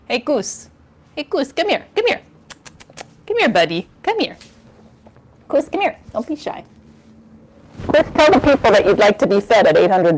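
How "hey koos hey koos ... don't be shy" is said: higher, soft coaxing call; calling the cat. Real, not acted. Owner coaxing her cat Koos to the camera, calling softly. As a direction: coax a shy cat over.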